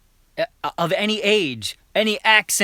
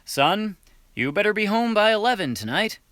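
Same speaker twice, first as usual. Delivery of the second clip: lightly voiced dad; 6 semitones higher than usual. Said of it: "Jim said to his son".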